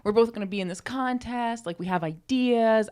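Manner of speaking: high, eager hopeful quote, playfully; excited retelling. Retold or imitated. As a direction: gush with excitement about the contest. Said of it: "So Lazu and I was like".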